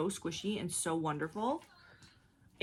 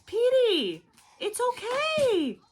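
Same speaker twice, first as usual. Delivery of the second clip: calling out; 12 dB louder, slower than usual. Real, not acted. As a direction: call Peter from across the room, sing-song. Calling out to upset toddler.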